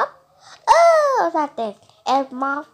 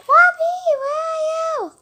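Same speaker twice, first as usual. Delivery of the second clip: high wailing shout for Bobby; crying call; 12 semitones higher, 7 dB louder than usual. Pretend. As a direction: wail out for the lost baby. Child playing mother calling baby.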